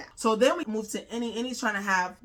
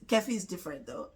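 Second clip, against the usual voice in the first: ordinary delivery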